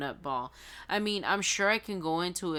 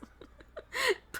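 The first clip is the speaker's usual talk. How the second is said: very high giggle; suppressed squeal of laughter. Real, not acted. Reader promising guys not to repeat it, high giggle.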